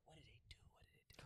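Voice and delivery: barely audible whisper, trying to recall; whispered to self. Real, not acted. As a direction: whisper it privately while searching your memory. Asks self, trying to recall the story.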